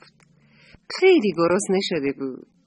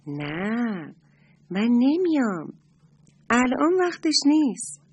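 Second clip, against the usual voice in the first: higher, slow refusal in a crab's voice; stubborn crab; 4 semitones higher, slower than usual. Acted. The crab refusing his friend the little moon, slow and firm.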